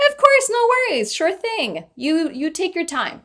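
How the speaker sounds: hammed-up friendly reassurance; mock sweet. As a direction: imitate an overly accommodating clerk. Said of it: "they would have been like".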